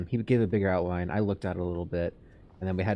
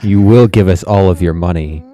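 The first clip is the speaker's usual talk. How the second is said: booming command; 18 dB louder than usual. Pretend. Demanding money from fan jokingly.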